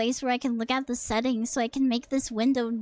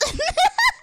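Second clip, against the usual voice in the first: high burst of laughter; 5 dB louder than usual. Real, not acted. Laughing at own question.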